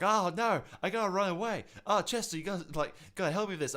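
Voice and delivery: high, loud panicky pleading; whiny teammate. Retold or imitated. Friend begging Chester for help, high panicky whine. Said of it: "B J's like".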